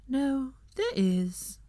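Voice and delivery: slow, sad admission; drawn out slowly with regret. A narrator's reading. Luna to Draco, slow and sad. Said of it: "said Luna sadly".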